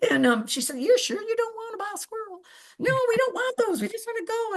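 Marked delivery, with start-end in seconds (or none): 0.8-2.4 much higher, sing-song saleswoman pitch; Kathy Bates voice. Retold or imitated. Kathy Bates asking again. 2.8-4.6 much higher, impatient squeaky no; high mock refusal. Whoopi refusing again.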